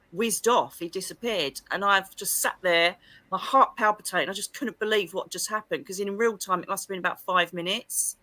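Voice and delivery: normal talking voice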